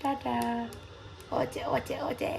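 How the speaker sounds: breathy whispered call; hushed playful whisper. Real, not acted. Playing with sleepy toddler.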